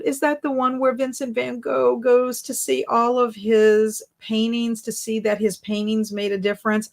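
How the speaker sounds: ordinary talk, nothing marked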